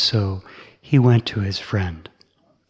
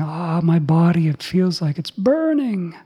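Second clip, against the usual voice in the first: higher pained cry; 7 semitones higher than usual. Acted. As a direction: cry out as if your body is burning. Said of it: "he cried out".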